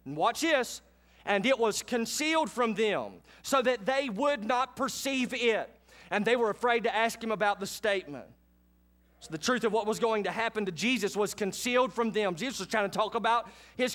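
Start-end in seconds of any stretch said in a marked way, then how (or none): 1.2-8.3 scripture reading. Acted. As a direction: let each phrase of the verse land.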